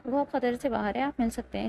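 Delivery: ordinary delivery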